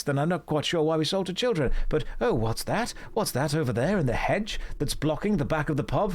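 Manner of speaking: high, excited cockney street urchin; chirpy old London cockney lad; Victorian urchin. Retold or imitated. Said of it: "I was basically like a little Victorian urchin".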